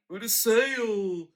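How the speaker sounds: 2 imitating the neighbor's slow, high, angry snap; 4 hammed-up imitation of an irritated Japanese neighbor; 1 neighbor's angry retort. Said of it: "He's like".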